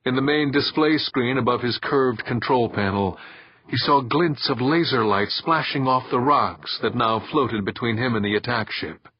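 ordinary delivery, nothing marked

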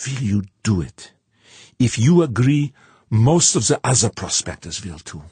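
burning intensity. A narrator's reading. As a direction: press hard for a yes. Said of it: "With burning intensity".

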